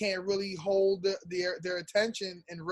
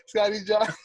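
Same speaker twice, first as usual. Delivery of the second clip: laughing; 5 semitones higher, 6 dB louder than usual. Pretend. Mocking the name, laughing.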